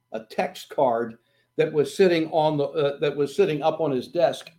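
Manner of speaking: normal talking voice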